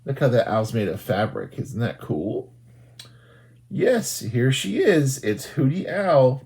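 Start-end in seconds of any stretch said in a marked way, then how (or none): none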